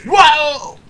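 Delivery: high, loud whoa; sharp cry of gamer surprise; startled shout. Real, not acted. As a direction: yell in sudden shock. Startled during game.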